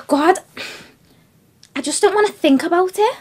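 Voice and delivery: exasperated distress. Acted. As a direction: push the thought away, voice rising. Overwhelmed by thoughts of the trouble.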